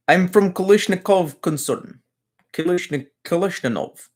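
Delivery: a viewer asked for it, so a mock Russian accent. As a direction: ham it up like a Russian gun salesman. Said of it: "Put on a Russian accent and say".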